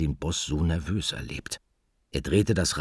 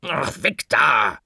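higher, louder slow scold; nervous driver scolding the magpie off the wheel; 10 semitones higher, 6 dB louder, slower than usual. Acted. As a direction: shoo the bird off, grumbling. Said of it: "schimpfte Mortimer".

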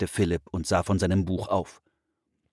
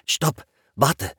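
raised-pitch call to stop; calling halt; 6 semitones higher than usual. A narrator's reading. Boy halts at the door, calling to his sister. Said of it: "rief Philipp und blieb stehen".

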